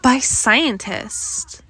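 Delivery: ordinary talk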